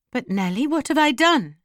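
indignant, high-pitched question; protesting cry. A narrator's reading. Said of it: "she cried".

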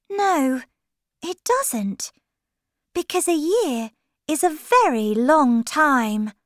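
put-on high-pitched girl character voice; Alice. A narrator's reading. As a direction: explain it in a high storybook voice. Alice answers the Hatter.

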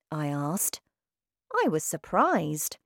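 ordinary delivery

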